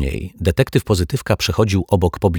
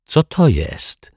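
slow, higher-pitched question; taken aback; 9 semitones higher, slower than usual. A narrator's reading. Detective spots a yellow wallet, startled question. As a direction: ask slowly with rising pitch.

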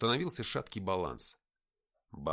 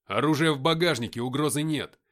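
loud, firm, raised voice; рявкающий доклад; 4 semitones higher, 9 dB louder than usual. A narrator's reading. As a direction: bark out a reassurance to armed soldiers. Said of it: "рявкнул я". (громко и твёрдо рявкает)

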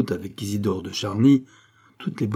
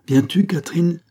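calling out; 8 semitones higher, 4 dB louder than usual. Acted. Mother calling daughter away.

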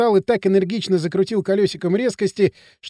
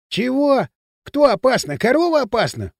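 higher, incredulous questions; scornful disbelief; 5 semitones higher than usual. Acted. Пакля scoffs at his friend fearing a cow, incredulous. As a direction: throw the worry back in disbelief.

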